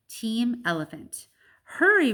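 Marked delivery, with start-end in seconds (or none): 1.6-2.1 high, loud urgent cry; urgent high shout. Acted. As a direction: shout it in a panic. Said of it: "Ruby cries".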